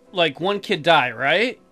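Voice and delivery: ordinary delivery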